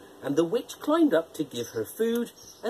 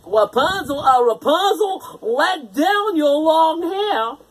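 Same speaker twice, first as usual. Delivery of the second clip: louder, slow imitation of the witch's call; prince calling up; 9 semitones higher, 6 dB louder, slower than usual. A narrator's reading. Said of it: "the prince came".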